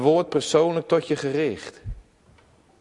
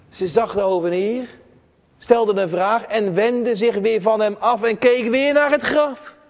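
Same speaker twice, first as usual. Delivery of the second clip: normal talking voice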